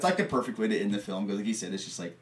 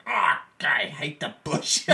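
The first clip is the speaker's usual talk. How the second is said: retold Mick gripe; 6 semitones higher, 4 dB louder, slower than usual. Mick steps on something, complains.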